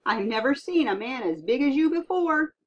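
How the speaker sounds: a stunned sea captain marvelling; amazed captain. Acted. Captain amazed at giant boy.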